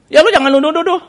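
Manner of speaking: high-pitched indignant retort; mock a wife pushing back; acted-out quarrelling; retold defensive wife.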